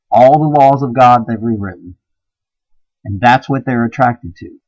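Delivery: fiery preaching. Acted. Preacher rails against feminine men, loud and fervent. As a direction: hammer it home like a street preacher.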